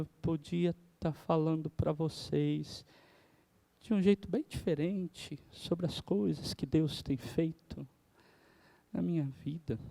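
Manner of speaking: soft, droning monotone, lightly done; a touch of a boring, sleepy sermon voice; sleepy monotone demo. Shown as a demonstration. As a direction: drone on so softly the church dozes off. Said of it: "a metade que tá dormindo já tava roncando".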